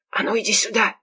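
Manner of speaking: hushed, urgent command; whispered order. A narrator's reading. Mila summons Kurakin to the crying friend, commanding whisper. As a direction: order someone over under your breath. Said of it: "шёпотом приказала".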